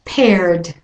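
Paired with an American R before the D; American accent: keep the R in Paired, then D. Shown as a demonstration. Teacher models American paired. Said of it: "And again".

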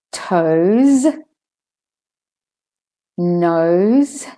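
"toes, nose, husband, position, always, runs" with voiced z for each s; word list, buzzing the z in "toes" through "runs". Shown as a demonstration. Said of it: "where the S says Z".

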